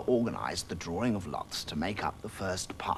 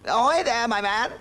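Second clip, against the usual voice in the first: loud, high hailing call; Humphrey hailing a fisherman; shouted; 13 semitones higher, 9 dB louder than usual. Acted. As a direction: hail the man across the water. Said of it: "shouted Humphrey".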